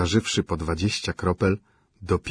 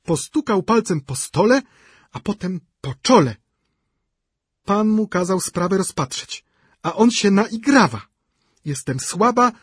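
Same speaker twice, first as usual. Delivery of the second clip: shrill complaining widow; 12 semitones higher, 6 dB louder than usual. A narrator's reading. Szczukina voiced by reader.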